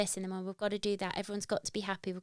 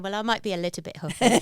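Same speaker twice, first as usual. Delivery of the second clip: usual speaking voice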